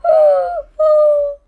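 loud, high hammed-up sob; big theatrical sob gasp; mock sobbing gasp. Reader mock-crying.

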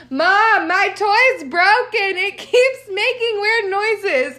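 lightly voiced small son at the door, puzzled; little boy.

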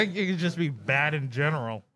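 ordinary talk

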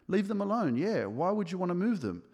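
moved by his brother-in-law's words, voice leaping up; excited realization. Retold or imitated. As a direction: lift it high as the idea clicks. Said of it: "because I was like".

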